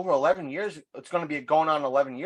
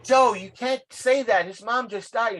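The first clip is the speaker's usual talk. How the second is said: lightly voiced, higher scandalized coworker; 7 semitones higher than usual. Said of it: "He's like".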